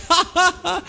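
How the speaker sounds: high staged ha ha ha; mock laughter. Bikies laugh at the cops, high and hammed-up. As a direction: laugh it out theatrically. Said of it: "And they were like".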